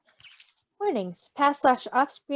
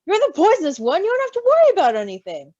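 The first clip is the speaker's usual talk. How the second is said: higher and louder, a light teasing jab; lightly voiced teasing Roman; 7 semitones higher, 7 dB louder than usual. A narrator's reading. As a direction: needle him playfully, a touch higher. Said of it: "Roman teasing Virgil in story".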